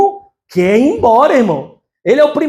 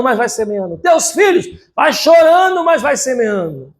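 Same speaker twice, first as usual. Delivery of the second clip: rising cry on chorando, falling on semeando; chanted sermon refrain; preaching refrain; 3 semitones higher than usual. Acted. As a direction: call it out to the congregation.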